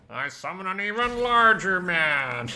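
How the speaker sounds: high, loud, drawn-out mock summoning cry; over-the-top theatrical proclamation; hammed-up bellow. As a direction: boom it out slowly, swelling to a yell. Quotes a known clip, hammed up.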